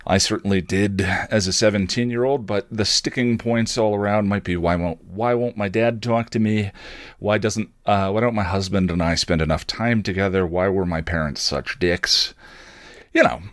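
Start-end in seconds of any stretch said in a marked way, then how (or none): none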